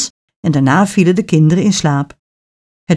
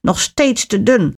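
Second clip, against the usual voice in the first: witch's mildly raised complaint; calling out; 3 semitones higher than usual. A narrator's reading. Witch checks caged Hans's finger, mildly calling out. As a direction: grumble it out in a lightly put-on witch voice. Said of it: "riep de heks dan".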